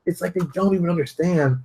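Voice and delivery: ordinary talk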